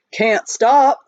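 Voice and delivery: lightly voiced miner talking through a swollen cheek; a hint of a mouthful of gravel; mumbling miner with a toothache, lightly done; gravelly miner. A narrator's reading. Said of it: "as if he had a mouthful of gravel".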